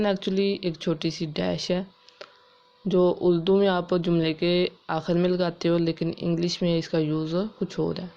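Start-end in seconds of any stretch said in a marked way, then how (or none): none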